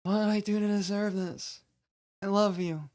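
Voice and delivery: high-pitched, stilted overacted plea; bad acting. Shown as a demonstration. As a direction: play it like a bad actor. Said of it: "here's the bad acting again".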